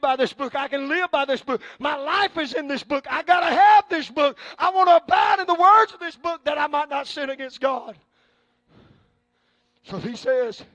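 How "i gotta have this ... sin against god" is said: preaching shout. Acted. Preacher shouting his need for the book to the congregation. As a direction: hammer it home.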